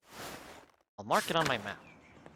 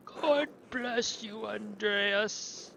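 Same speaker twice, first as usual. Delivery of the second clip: voiced monk blessing; 7 semitones higher, much slower than usual. Voicing character in game.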